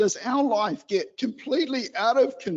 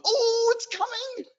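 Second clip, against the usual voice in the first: put-on high, breathless childish thrill; a gushing child's voice; excited child; 12 semitones higher than usual. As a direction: squeal with eager anticipation. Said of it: "Are we going".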